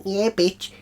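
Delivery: ordinary talk, nothing marked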